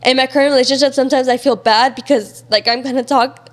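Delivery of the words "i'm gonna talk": ordinary talk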